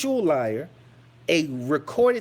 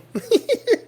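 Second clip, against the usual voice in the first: loud high laugh rising at the end; real high laugh, louder; 4 dB louder than usual. Real, not acted. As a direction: burst out laughing, pitched up. Laughing again after "So.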